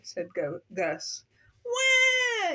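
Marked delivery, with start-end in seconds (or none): 1.7-2.5 loud, high imitated infant cry; piercingly high storybook "wah"; yelled baby wail. A narrator's reading. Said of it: "yelled baby ghost".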